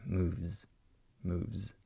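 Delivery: "Moves" ending on a short buzzing z; final z buzzed. Shown as a demonstration. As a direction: let the z of "Moves" vibrate briefly. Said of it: "That final z".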